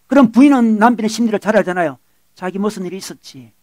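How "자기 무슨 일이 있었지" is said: much softer, acted gentle question; soft wifely concern. Wife reads her upset husband's mood, softly asking. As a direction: imitate a wife asking carefully.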